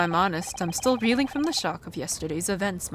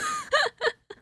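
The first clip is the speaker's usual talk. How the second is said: high squealing giggle. Acted. High crying laugh.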